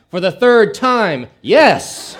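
loud, high, slow exasperated reply; fed-up wife repeating herself emphatically; annoyed. Acted. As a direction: snap it out loudly, landing hard on the last word. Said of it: "with a slightly annoyed expression".

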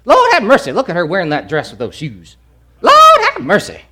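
high, loud scandalised tutting with a laugh; mock a churchgoer judging a dress; gossiping Lord have mercy. Pastor imitating a gossip judging a woman's dress, high and mocking. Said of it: "derogatory term".

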